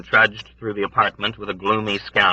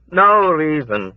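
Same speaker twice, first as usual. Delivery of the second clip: high, slow, louder wail; wailing old woman; 8 semitones higher, 5 dB louder, slower than usual. A narrator's reading. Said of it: "wailed the old woman".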